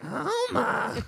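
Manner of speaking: loud cartoon dad voice; Homer Simpson voice. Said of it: "Homer impression".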